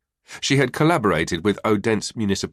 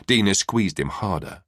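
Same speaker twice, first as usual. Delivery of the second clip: ordinary delivery, nothing marked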